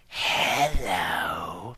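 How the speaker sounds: low, far-off creepy hello; a sinister voice from the dark. Acted. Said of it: "said a voice".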